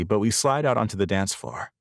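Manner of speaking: usual speaking voice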